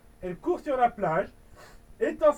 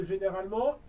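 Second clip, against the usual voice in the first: ordinary delivery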